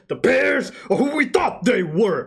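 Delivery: shouted imitation of a coach losing it; hammed-up press conference outburst; mock angry rant. Imitating Dennis Green rant.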